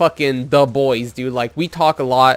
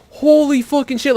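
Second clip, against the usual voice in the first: imitated amazed outburst, pitched way up; losing his mind; 9 semitones higher than usual. Friend reacts to seeing him, losing his mind. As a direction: mimic a friend freaking out with excitement. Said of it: "he loses his mind".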